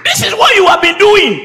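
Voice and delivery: high, loud pulpit accusation; accusing shout. Real, not acted. Preacher accuses congregation of pretending, loud shout. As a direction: call them out at full volume.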